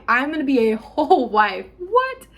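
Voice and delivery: laughing, What squeaked up high; giddy disbelief. Retold or imitated. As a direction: laugh at the thought, then squeak in shock.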